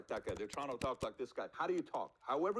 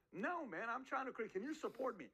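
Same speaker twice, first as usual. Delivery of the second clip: high-pitched mock protest; hammed-up defensive plea, pitched high; pleading; 9 semitones higher than usual. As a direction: plead for support from doubting friends. Acting younger self pleading with doubters.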